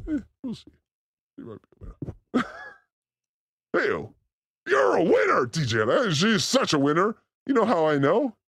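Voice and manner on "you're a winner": high shouted praise. Real, not acted.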